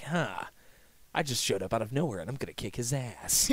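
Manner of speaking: Snake voice. Pretend. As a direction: mutter it like a stone-cold soldier. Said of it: "And be like".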